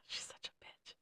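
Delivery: slow, breathy whisper after a sigh; whispered insult. Real, not acted. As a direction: whisper it so nobody hears. Whispered insult about coworker.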